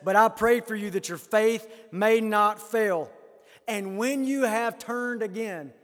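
preacher declaiming a promise; emphatic scripture reading. Acted. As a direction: proclaim it phrase by phrase.

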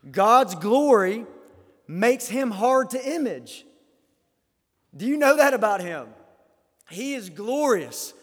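loud, slow, high-pitched proclamation; impassioned preaching. Real, not acted. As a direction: proclaim it to the whole congregation. Preaches on God's glory.

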